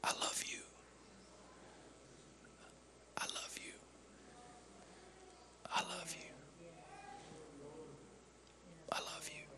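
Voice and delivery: breathy unvoiced whisper, long pauses. Blood on the cross whispering to hearers, whispered. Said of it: "is silently whispering".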